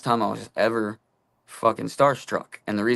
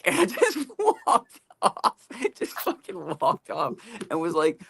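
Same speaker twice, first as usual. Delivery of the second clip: laughing; 8 semitones higher than usual. Real, not acted. Laughing at own embarrassing story.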